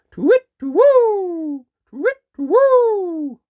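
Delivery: very high, loud, drawn-out owl call; owl hoot. Acted. Owl hooting outside Peter's lonely room. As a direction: hoot it long like an owl in the dark.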